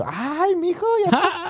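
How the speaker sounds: high cooing tia praising her nephew. Retold or imitated. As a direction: gush like a proud Mexican aunt. Tias complimenting in Spanish.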